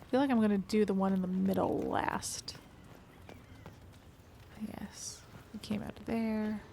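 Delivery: hushed whisper to himself. Real, not acted. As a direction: whisper it under your breath. Quiet aside while playing.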